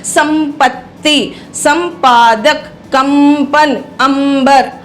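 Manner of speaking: संपत्ति, संपादक, कंपन, अंबर broken up with a lingering m; deliberate demo of संपत्ति and संपादक, m clearly sounded; anusvar as m. Shown as a demonstration. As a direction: hold the m in कंपन and अंबर before the next syllable. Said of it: "वो म है".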